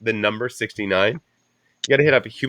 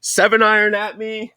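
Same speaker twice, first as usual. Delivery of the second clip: mock outraged golfer; 10 semitones higher, 3 dB louder than usual. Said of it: "We're like".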